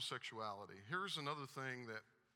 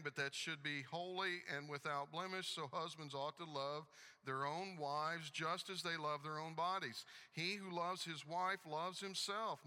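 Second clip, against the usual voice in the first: higher, louder preaching read; impassioned scripture reading; 5 semitones higher, 4 dB louder than usual. Acted. Preacher reading to the church about husbands loving wives, impassioned. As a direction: read scripture out with rising intensity. Reads scripture aloud to congregation.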